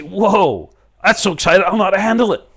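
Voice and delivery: loud, high, wowed outburst; overwhelmed excitement. Acted. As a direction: burst out, thrilled beyond coping. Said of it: "And say".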